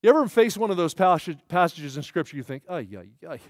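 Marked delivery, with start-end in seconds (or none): 2.7-3.4 low, soft, daunted muttering; daunted groan. Acted. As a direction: mutter it low, overwhelmed by the task. Reacting to a hard passage.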